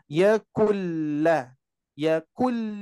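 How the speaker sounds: YA KULLA drawn out slowly, holding the l; demonstrated recitation. Shown as a demonstration. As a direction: hold the middle of "ya kulla". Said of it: "sama tawasutnya". ("ya kulla" dibaca panjang dengan tawasut)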